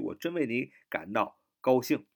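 ordinary talk, nothing marked

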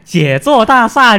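high, lilting Cantonese Mandarin; Cantonese accent in Mandarin. Retold or imitated. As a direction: say it like a Cantonese speaker. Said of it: "广东人他那个普通话说的不好".

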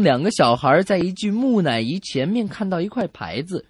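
ordinary delivery

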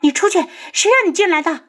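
high, rushed, frightened cry; panicked. A narrator's reading. Startled woman orders the intruder out, panicked. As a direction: shriek it fast in alarm. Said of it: "就见女子惊慌的道".

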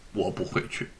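muttered low and soft; a hurt, withdrawn no; softly refusing to go home; quiet sulking refusal. A narrator's reading.

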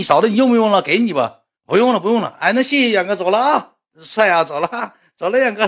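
high bright goodbye voices; polite farewells. Retold or imitated. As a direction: wrap up politely and leave. 双方告别对话. (高声客气道别)

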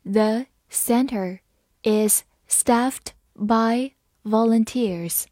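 slow, phrase by phrase; slow reading. Shown as a demonstration. Teacher reading slowly for students to follow. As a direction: read it slowly for the learners. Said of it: "好，慢慢来读。".

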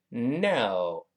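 "no" with tongue slid back, slow; correct no. Shown as a demonstration. Teacher models the correct "no", slow. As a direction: draw out "no" correctly. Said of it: "再发那个哦".

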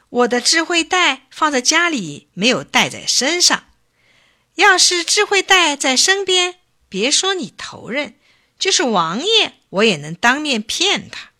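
high-pitched bragging excuse; boastful trickster. A narrator's reading. Bacang claims his wisdom bag is at home, boastful. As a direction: brag high and lively to the chief.

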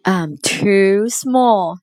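slow, drawn-out little complaint; mild wail. A narrator's reading. Puppy complaining about being too small, mild slow wail. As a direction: lightly wail it, stretching the words. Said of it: "wailed the puppy".

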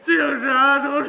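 drawn-out hammed-up wail; slow mock sob to soften the cop; hammed-up fake sob, slow and loud; fake crying. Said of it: "这就哭上了".

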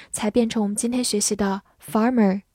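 ordinary delivery

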